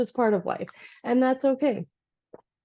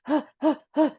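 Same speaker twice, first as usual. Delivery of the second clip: scared panting; 5 semitones higher than usual. Retold or imitated.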